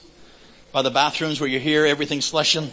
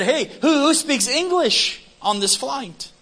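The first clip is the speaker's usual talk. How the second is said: high, drawn-out call to a whole cabin; retold public appeal, pitched well up; 9 semitones higher, slower than usual. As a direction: call out for help from any passenger. Retold calling out to passengers.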